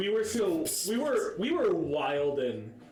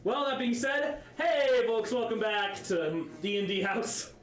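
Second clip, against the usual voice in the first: upbeat, raised show-opening voice; podcast host intro; 5 semitones higher than usual. Acted. Host opening D and D House for listeners, intro voice. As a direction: welcome listeners with an announcer's lift.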